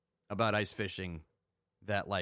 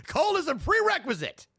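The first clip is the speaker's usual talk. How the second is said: laughing it out high; 5 dB louder than usual. Real, not acted. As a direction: repeat it through a laugh. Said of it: "laughing repeat".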